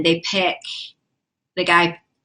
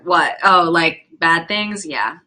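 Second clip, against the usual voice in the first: normal talking voice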